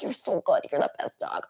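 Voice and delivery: low, soft praise to a dog; understated low-voiced praise. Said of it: "you have a lower voice".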